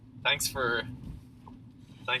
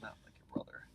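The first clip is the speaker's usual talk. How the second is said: muttered aside; 12 semitones lower, 17 dB quieter than usual. Real, not acted.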